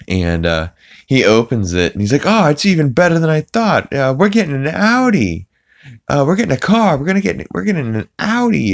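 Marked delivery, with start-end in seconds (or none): 2.3-8.7 high-pitched, gleeful cartoon-dad impression; excited Peter. Peter opening a letter and announcing a new car, mock excitement. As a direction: crow it in a pitched-up imitation.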